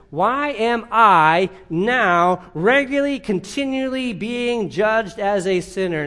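pressing the question home to the congregation; emphatic preaching. Acted. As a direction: preach it slowly and insistently, word by word.

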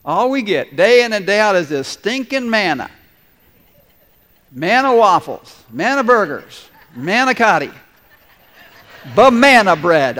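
put-on grumbling about the same old food, building to a loud rant; mock grumbling congregation voice; whiny complaining Israelites. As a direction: start as a sulky whine and work up to a loud fed-up complaint. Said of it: "they started to think".

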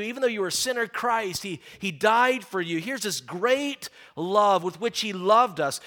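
usual speaking voice

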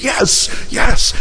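high, breathy mock sobbed yes; imitated weeping. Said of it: "she's weeping, and she goes".

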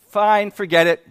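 loud, fed-up snap; exasperated outburst. Retold or imitated. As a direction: blurt it out, done with it all. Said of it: "I just was like".

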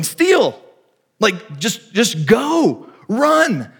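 high-pitched, insistent calls; urgent urging. Retold or imitated. Jesus as coach urging hesitant Christians, insistent. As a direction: urge them off the base, pitch jumping up.

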